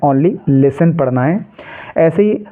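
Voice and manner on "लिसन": "लिसन" with no t sound; corrected "लिसन", s straight into a short -an; silent T. Shown as a demonstration. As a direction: drop the T in "लिसन". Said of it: "उच्चारण आपको नहीं करना".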